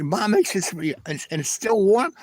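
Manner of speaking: high, incredulous acted-out question; imitated wide-eyed amazement; amazed. Acts out amazed reply.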